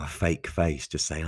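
ordinary delivery

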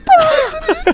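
put-on very high falsetto girl, half crying; young woman voice. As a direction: squeal it like a distraught young woman. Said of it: "entra na igreja e diz".

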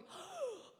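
high, sharp gasp of shock; acted gasp of horror; horrified gasp. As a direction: gasp like an appalled bystander. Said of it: "she was going".

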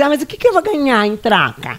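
put-on child bargaining. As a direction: ask like a little dealmaker. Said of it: "Daí eu falava assim".

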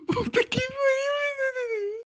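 high squeaky laugh-cry; crying with laughter. Real, not acted. Helpless laughter, can't stop.

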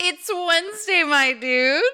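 slow, high, loud meme drawl. Pretend. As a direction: belt it like the Wednesday frog meme. Meme catchphrase voice.

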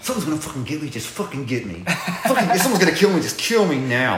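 hammed-up plea to get it over with; comic fed-up panic; mock desperate surrender. Acts out daring monsters in dark.

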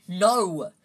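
loud, forceful refusal; angry, loud outburst. A narrator's reading. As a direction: snap it out angrily at full voice. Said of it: "said Harry angrily".

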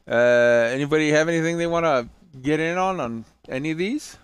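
ordinary talk, nothing marked

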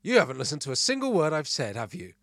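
half-hearted yell of reproach; mock scream. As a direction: play an irritated wife telling off. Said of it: "My wife screamed".

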